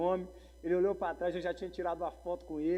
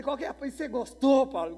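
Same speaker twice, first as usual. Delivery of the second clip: eager questioning; 9 semitones higher, 11 dB louder than usual. Retold or imitated. Acting out his eager questioning.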